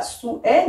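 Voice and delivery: "souhait" split into sou-è; two syllables in "souhait", ou then è; diaeresis. Shown as a demonstration. Teacher contrasting with everyday speech, "souhait" split sou-è. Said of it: "on devrait avoir une diérèse".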